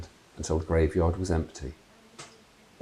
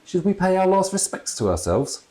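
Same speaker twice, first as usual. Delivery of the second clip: lightly voiced wry ghost dad; a dry joke at his own funeral; a touch of gallows humour; dad's voice; 8 semitones higher, 4 dB louder than usual.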